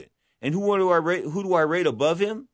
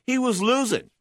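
ordinary talk, nothing marked

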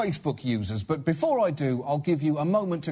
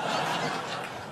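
breathy chuckle; 6 dB quieter than usual. Real, not acted. Laughs mid sentence.

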